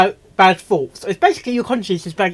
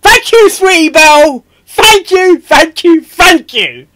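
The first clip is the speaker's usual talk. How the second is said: higher, louder cartoon-filly voice; high squeaky thanks; 7 semitones higher, 10 dB louder than usual. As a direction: squeal your thanks like a little pony. Thanking in Sweetie Belle voice.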